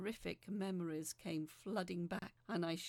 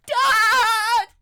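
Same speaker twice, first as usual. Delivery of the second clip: desperate full-voice scream; panicked high scream of "Dad!"; 14 semitones higher, 28 dB louder than usual. Acted. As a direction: scream for your father as he jumps in. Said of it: "I screamed".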